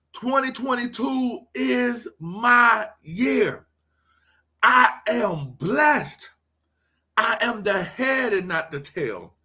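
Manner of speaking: preached affirmations. Acted. Pastor leading the family in affirmations, preached declarations. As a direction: proclaim each line as a faith affirmation.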